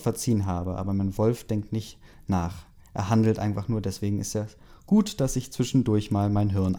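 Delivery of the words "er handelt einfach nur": ordinary delivery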